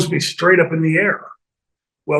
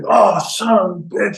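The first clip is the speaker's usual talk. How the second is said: hammed-up pain; 5 semitones higher than usual. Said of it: "just overselling his, you know, broken nose".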